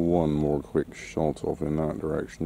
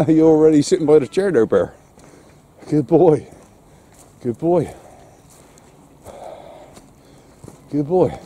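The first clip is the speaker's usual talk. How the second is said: high, bright sing-song praise for the dog, louder; 10 semitones higher, 8 dB louder than usual. Real, not acted. Praising his dog Bear for sitting by the chair, high sing-song voice. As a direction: coo over a good dog.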